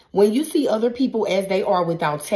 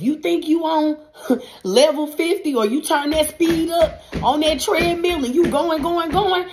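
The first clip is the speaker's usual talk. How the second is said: higher and louder with a laugh, speeding up; excited; 9 semitones higher, 4 dB louder than usual. Real, not acted. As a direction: get carried away, laughing. Describes rushing ahead, laughing.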